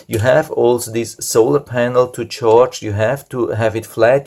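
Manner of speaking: usual speaking voice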